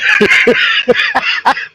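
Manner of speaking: high airy laugh; high breathy laugh. Real, not acted. Vlogger laughing at his son's story. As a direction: let out a light laugh.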